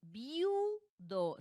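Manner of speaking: "Viudo" in two syllables, VIU held long; stress on U. Shown as a demonstration. Teacher repeating the example for students, "Viudo" with long stressed U. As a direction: lean on a drawn-out U in "Viudo", then drop to "do". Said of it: "la sílaba tónica recae en la U".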